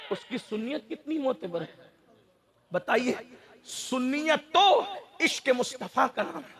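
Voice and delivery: sudden drop to a soft voice; hushed preaching. Real, not acted. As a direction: put the question softly to the congregation. Sudden hush after shouted climax.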